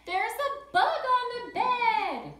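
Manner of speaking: high, loud, slow panicked scream; screaming at the bug on the bed. A narrator's reading. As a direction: shriek it out like a mother finding a bug. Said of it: "And she screamed".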